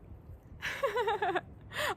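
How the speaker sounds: teary, breathy laugh; moved laugh while fighting tears; laughing near tears. Real, not acted. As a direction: laugh through welling emotion. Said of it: "I'm trying not to cry".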